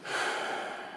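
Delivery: a soft resigned sigh; weary sigh. Acted. Captain sighs as she insists on going, soft. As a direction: let out a quiet breath of giving in.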